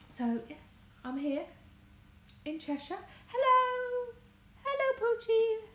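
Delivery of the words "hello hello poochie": high, loud, bright hello to a new viewer; sing-song hello to a friend arriving; excited greeting. Real, not acted. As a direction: call out a delighted welcome, much higher and louder. Greeting someone joining the stream.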